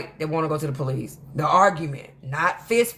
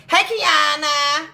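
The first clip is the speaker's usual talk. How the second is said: high, loud Mickey Mouse voice; drawn-out squeaky cartoon greeting; Candy; 9 semitones higher, 5 dB louder, much slower than usual. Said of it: "she has this real Mickey Mouse voice".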